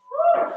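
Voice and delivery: high, loud short woo; whoop. Real, not acted. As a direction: give a sharp, high cheer. Cheering on a workout class.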